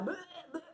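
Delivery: gagging noises, laughing; retching sound. Retold or imitated. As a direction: fake retch, half laughing. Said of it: "I'm like".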